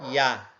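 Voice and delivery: "Iá" com o A bem aberto. Shown as a demonstration. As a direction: diga "Iá" abrindo o A. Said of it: "Um A aberto". (pronúncia de Iá com A aberto)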